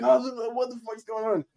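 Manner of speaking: high-pitched mock bewilderment; retold disbelief. Reliving godmom's happy dance and running dogs, bewildered. Acts out joyful disbelief amid celebration.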